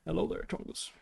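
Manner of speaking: soft breathy hello to the cat; greeting his cat on stream in a whisper. Real, not acted. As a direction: whisper it like cooing at a pet.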